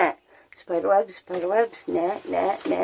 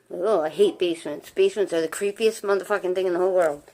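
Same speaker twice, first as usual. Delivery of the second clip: normal talking voice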